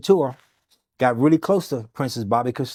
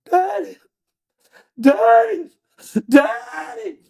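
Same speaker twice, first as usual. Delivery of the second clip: high, crying scream, getting louder; screaming for daddy; 4 dB louder, slower than usual. Retold or imitated. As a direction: wail for your father like a waking patient. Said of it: "She screamed".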